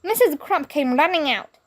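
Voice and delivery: ordinary delivery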